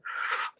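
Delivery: sharp breathless gasp of surprise; imitated gasp. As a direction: gasp like someone overwhelmed by a gift. Birthday girl reacts to gift.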